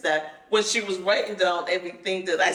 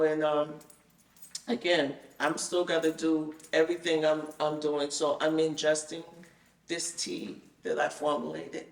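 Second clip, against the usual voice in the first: ordinary talk, nothing marked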